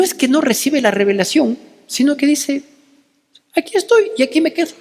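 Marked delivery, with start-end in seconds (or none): 3.5-4.7 defiant stand. Retold or imitated. Prophet refusing to flee arrest.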